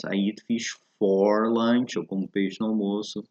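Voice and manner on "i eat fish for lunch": slow model phrase. Shown as a demonstration. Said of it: "nas outras expressões".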